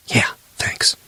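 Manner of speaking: breathless whispered sarcasm; pinned down by a monster, whispering sarcasm; terrified whisper. Acted. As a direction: whisper it under the creature's weight. Terrified elf's sarcastic aside.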